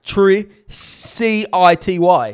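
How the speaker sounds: "C I T Y" spelled letter by letter, slowly. Shown as a demonstration. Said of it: "How do you say city?".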